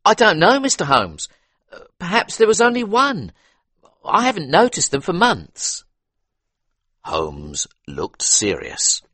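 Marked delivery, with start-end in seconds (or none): 0.0-5.8 higher, deferential butler. A narrator's reading. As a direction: answer politely as a servant. Butler answers.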